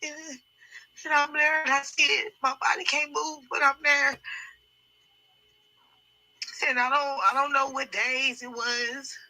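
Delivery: high, tearful voice reliving it; real distress. Real, not acted. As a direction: struggle through a painful memory. Distressed while reliving a traumatic memory.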